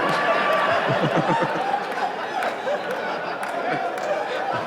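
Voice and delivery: long high wheezing laugh; breathy laugh. Real, not acted. Laughing at the question.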